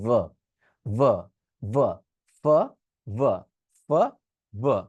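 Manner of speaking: ভা with a buzzing v, ফ with air only; v versus f drill. Shown as a demonstration. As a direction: contrast voiced ভা with breathy ফ. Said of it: "গলায় হাত দিয়ে". (ভ আর ফ এর পার্থক্য)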